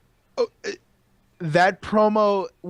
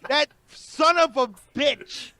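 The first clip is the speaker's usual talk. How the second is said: higher, drawn-out mock cursing; pent-up anger; 6 semitones higher, slower than usual. Imitating her wanting to curse her brother, pent-up anger. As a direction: voice her held-back insults, stretching them out. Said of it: "she wanted to let that out".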